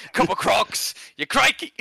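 high-pitched, hammed-up Australian accent; mock Australian accent. Mocking Australian slang for friends, exaggerated Australian accent.

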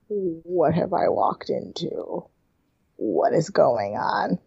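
slow, soft and confused; baffled Camille. Retold or imitated. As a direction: wander in totally lost, slowly. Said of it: "She's like".

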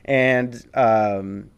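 ordinary talk, nothing marked

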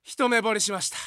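love confession yelled out loud. Retold or imitated. Junior loudly announcing love at first sight in the queue, shouting. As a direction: blurt the crush at full voice. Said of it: "でっかいな、声。".

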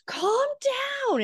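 mock scream. Parent telling their kid to calm down, mock scream. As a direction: imitate a parent losing it, high and strained. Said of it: "they're screaming at their kid".

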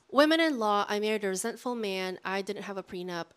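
ordinary talk, nothing marked

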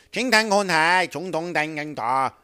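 jabber in a put-on Chinese accent; fake Chinese gibberish. Comic mocking foreigners with fake Chinese. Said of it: "what language do you think I'm fake speaking".

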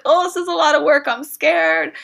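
high, loud, fast mock whine; hammed-up fretting over a big task; mock scared. Voicing a reluctant listener facing new pursuits, mock scared. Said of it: "and be like".

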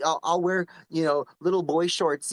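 ordinary delivery

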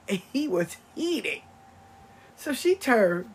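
ordinary talk, nothing marked

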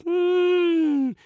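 high, loud moan; fish wail. A narrator's reading. Fish refused by the eagle, high miserable wail.

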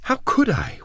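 high, pleading protest; alarmed protest. A narrator's reading. Woman denies she could leave, alarmed.

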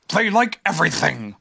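bouncy, confident creature voice; cheerful Tigger. A narrator's reading. Tigger boasts to Pooh about Tiggers, cheerful. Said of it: "said Tigger cheerfully".

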